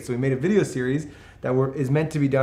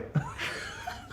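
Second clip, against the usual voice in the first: breathy laugh; 12 dB quieter than usual. Real, not acted. Laughing at own attempt.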